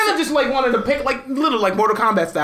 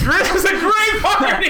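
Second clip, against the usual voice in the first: laughing; 6 semitones higher than usual. Real, not acted. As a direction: shout it through a laugh. Laughing mock party cheer.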